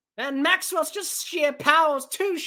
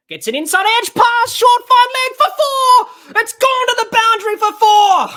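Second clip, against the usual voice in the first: loud, rising play-by-play shout; excited boundary call; 4 semitones higher, 7 dB louder than usual. Real, not acted. Commentator calls an edge racing to the boundary, excited shout. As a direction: yell the ball all the way to the rope.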